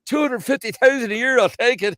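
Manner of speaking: very high, loud incredulous squeal; amazed. Acted. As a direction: squeal it in astonished Newfoundland accent. Amazed job seeker in acted joke.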